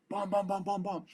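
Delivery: high rapid-fire vocal gunshots; imitated gunfire. Soldier drops down and starts engaging, imitated gunfire. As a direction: mimic a string of rifle shots.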